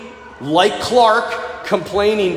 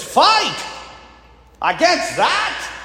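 higher, incredulous Scottish soldier; old soldier; 8 semitones higher, much slower than usual. Retold or imitated. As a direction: balk in disbelief as an old soldier. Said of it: "one of the old soldiers says".

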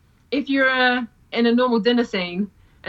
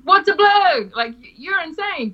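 high hammed-up outrage; dramatic alien; 8 semitones higher than usual. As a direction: overplay a shocked alien. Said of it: "a really dramatic alien going".

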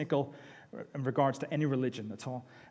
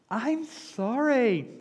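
higher, very slow, hammed-up sorry; overacted apology; 8 semitones higher, much slower than usual. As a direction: play the fake-sincere child. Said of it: "rewarding good acting".

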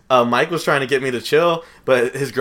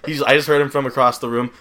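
ordinary talk

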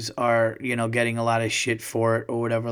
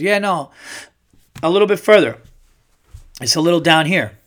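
son's confident voice; 4 semitones higher, 5 dB louder than usual. Retold or imitated. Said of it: "and then he goes".

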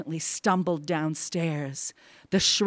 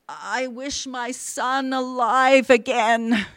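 slow, raised, solemn wish; reluctant wish; 6 semitones higher than usual. A narrator's reading. Husband wishes on the paw, slow and reluctant. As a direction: draw it out with dread. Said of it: "Reluctantly, he raised the talisman".

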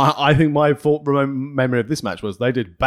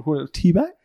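ordinary delivery

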